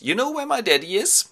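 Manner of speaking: put-on very high boyish question; Telemachos. Telemachos asks Nestor about Daddy, high boyish voice. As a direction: ask like a little lad, high and rising. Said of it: "Telemachos goes out of the boat and says".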